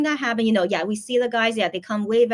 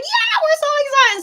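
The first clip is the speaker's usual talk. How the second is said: crowd cheering; 6 dB louder than usual. Retold or imitated. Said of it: "everyone's like".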